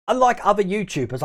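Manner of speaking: ordinary talk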